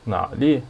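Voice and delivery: nali with a low half third tone on na; low-starting, drawn-out teaching take of nali. Shown as a demonstration. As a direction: keep na low, then let nali rise. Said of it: "This one is half third tone".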